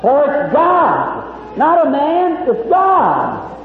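slow build to a shouted God; preaching shout. Real, not acted. Preacher to the crowd, rising to a shout. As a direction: drive it home, God not man.

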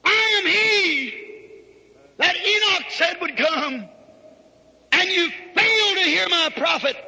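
preacher thundering as Christ to the lost; Christ's proclamation. Acted. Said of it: "He said".

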